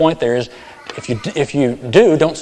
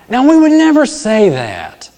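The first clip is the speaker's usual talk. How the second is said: mock incredulous; 11 semitones higher, 6 dB louder than usual. Mock aside after rude quote.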